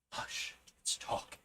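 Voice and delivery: hushed whisper. A narrator's reading. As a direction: breathe it very low. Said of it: "breathing very low".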